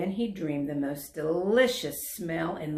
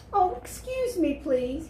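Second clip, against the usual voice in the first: put-on much higher, polite child voice; 11 semitones higher, 4 dB louder than usual. A narrator's reading. Dragon politely excusing his smoke puff, higher. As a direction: beg pardon sweetly. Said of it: "he said politely".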